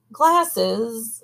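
plain reading voice

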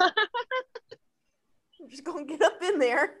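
slow mock Sheboygan accent. Copying the friend's proctologist bit, in a Sheboygan accent. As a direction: lightly echo a Sheboygan accent, slowly. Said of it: "Sheboygan sort of accent".

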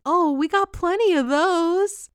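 hammed-up tender tone; sweet Fez. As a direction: answer her gently, like a shy crush. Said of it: "he so sweetly responded".

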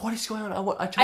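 high, incredulous inner complaint; alarmed protest. Retold or imitated. Acted alarm during painful massage.

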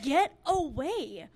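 slow, high, loud mock dismissal; aggressive friend, imitated. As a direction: stretch it out as a mock shooing yell. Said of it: "You're really aggressive".

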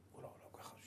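barely voiced dizzy whisper; faint whisper. Real, not acted. Rabbi recalling dizziness, whispering as the world spins. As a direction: fade into a breathless whisper.

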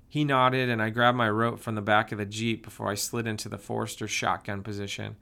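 plain reading voice

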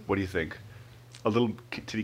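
usual speaking voice

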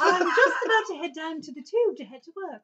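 put-on high, laughing English accent; cheerful English commuter voice. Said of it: "how's that sound?".

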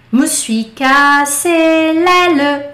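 me suis cassé l'aile, slow and careful; slow model phrase. Shown as a demonstration. Teacher modelling the phrase for students to repeat. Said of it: "vai repetir".

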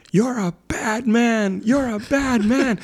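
put-on high, slow toddler voice, accusing; little girl scolding. Toddler scolding the neighbor who scared her, high and slow. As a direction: point the finger and scold like a two-year-old. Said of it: "And she screamed at him".

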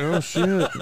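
retold startled outburst, laughing; laughing exclamation. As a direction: crack up mid-exclamation. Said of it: "I was like".

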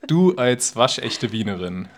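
normal talking voice

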